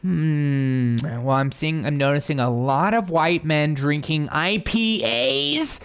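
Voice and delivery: slow, drawn-out "hmm" of mock scrutiny; mock inspector. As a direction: play a self-appointed investigator, stretching the words. Acts out people in anti-racist glasses.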